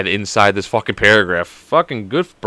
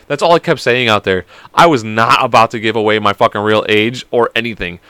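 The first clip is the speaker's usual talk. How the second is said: ordinary talk, nothing marked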